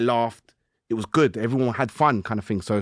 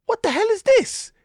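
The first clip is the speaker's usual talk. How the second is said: squeaky high astonished exclaim, a bit louder; amazed disbelief; 5 dB louder than usual. Retold or imitated. Retelling his endorphin rush to the host, in high astonishment. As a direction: voice jumping way up in amazement.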